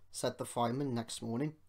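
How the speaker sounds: ordinary delivery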